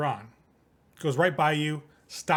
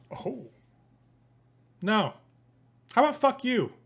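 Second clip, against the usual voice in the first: pitched-up mocking defiance; defiant [name]; 8 semitones higher than usual. [name] rejecting Larson.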